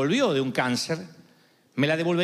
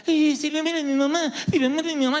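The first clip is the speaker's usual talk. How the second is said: mock panicked whimper; 8 semitones higher than usual. Preacher mocks his own fear of losing mom, whiny. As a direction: imitate your scared self, whiny.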